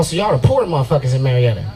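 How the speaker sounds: normal talking voice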